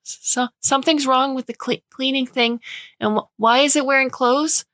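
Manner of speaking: put-on high, stuttering child voice, breaking off words; a scared little girl tripping over her words; frightened stammering child. A narrator's reading. Young daughter spooked by the thrashing robot, high and stammering.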